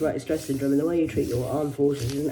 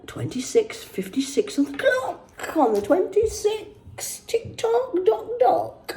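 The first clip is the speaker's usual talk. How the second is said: mock sing-song; slower than usual. Mocking clock rhyme, high.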